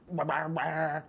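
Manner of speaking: put-on half-remembered Southern kid from a Vine; mock Southern twang on nonsense syllables. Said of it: "that really southern twang".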